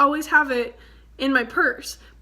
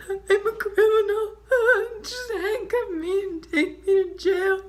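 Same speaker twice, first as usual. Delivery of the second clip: higher, laughing through tears; melodramatic tears; 7 semitones higher than usual. Retold or imitated. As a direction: beg dramatically to be arrested. Melodramatic tearful self-accusation.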